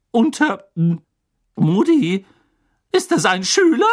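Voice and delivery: high, loud, broken-off question; scandalised, pitch leaping up; aghast disbelief. Acted. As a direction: break off, then cry out in horror. Professor realises, books fall.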